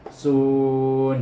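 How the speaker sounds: sun held long and loud; over enunciating. Shown as a demonstration. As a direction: stretch sun out. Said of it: "Kenapa saya baca panjang".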